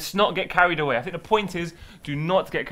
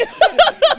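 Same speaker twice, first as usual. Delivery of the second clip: high shrieking laugh; 8 dB louder than usual. Real, not acted. Laughing at comment.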